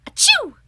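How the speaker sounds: big high sneeze; loud sneeze. Acted. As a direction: sneeze it out loudly. Amos sneezes.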